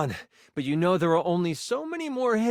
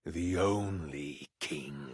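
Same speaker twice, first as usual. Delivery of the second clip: very low, slow, measured villain voice; low, ominous royal menace; sinister king; 12 semitones lower, 9 dB quieter, slower than usual. Acted. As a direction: savour each word as a dark monarch.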